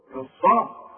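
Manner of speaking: كالصاء loud, with a thick emphatic ص, not an س; كالصاء with the ص pressed heavy; emphatic sad. Shown as a demonstration. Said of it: "مش كالسريع".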